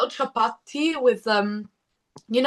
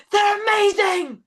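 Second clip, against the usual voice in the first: high, drawn-out gushing cry; excited exclamation; 10 semitones higher, slower than usual. Retold or imitated. Singer quoting a radio host's praise, excited exclamation. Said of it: "oh no, he went".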